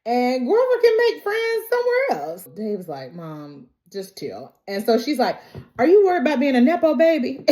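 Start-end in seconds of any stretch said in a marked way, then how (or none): 0.0-2.4 high, breezy brush-off; pushy mother dismissing the family's concerns; dismissive mom. Retold or imitated. Mom dismissing grandson's school. 3.1-4.6 much softer, lower weary plea; tired son. Retold or imitated. Dave calming his mother. 5.8-7.5 high, loud, fast teasing jab; mother teasing her son, mock jab; needling mom.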